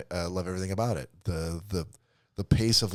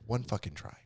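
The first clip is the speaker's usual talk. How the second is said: very soft, near-whispered plea; hushed coaxing; 11 dB quieter than usual. Real, not acted. Host coaxing co-host into one accent try, hushed plea. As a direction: drop right down and coax.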